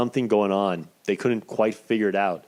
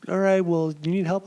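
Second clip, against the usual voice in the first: higher, doubtful nurse voice; lightly mocking, higher-pitched nurse; imitating the nurse; 9 semitones higher than usual. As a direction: mimic the nurse asking if he needs help. Nurse voiced in retold story.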